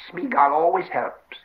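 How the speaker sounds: high, drawn-out creature voice; wheedling Gollum. Acted. Gollum offers his catch to the hobbits, wheedling. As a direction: play a put-upon Gollum, slow and high.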